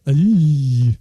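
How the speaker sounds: loud, low cringing "ee" through the teeth; imitated crowd wince. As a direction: mimic an audience wincing at a bad joke.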